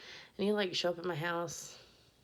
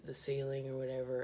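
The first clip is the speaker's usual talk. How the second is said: ordinary delivery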